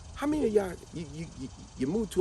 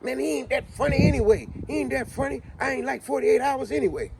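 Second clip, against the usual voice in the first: boastful heckler; 6 semitones higher than usual. Retold or imitated. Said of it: "He's like".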